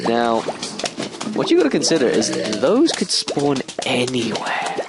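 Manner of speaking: ordinary talk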